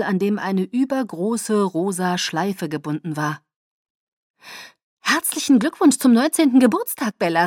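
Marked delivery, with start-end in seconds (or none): 5.0-7.5 higher, louder birthday cheer; calling out. A narrator's reading. Alice congratulates Bella on her birthday, calling out. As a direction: call it out as a festive congratulation. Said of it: "rief sie".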